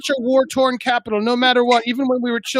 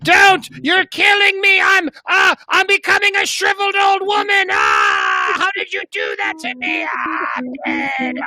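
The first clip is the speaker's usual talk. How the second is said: dying witch shrieks; 6 semitones higher than usual. Pretend. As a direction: shriek as you shrivel.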